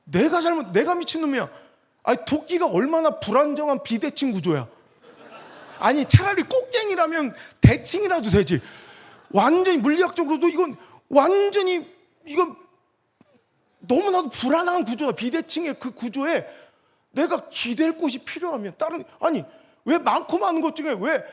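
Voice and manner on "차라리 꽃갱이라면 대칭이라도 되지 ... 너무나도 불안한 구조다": usual speaking voice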